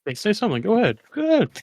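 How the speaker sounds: goading, pitch climbing; hyped-up egging on. Real, not acted. Egging on co-host.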